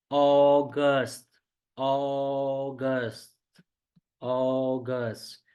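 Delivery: अगस्त stretched very slowly, over and over; slow repeated teacher demo of अगस्त; drawn-out pronunciation. Shown as a demonstration. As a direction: stretch out अगस्त so students hear each sound. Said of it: "बहुत सारे लोग गलती करते हैं".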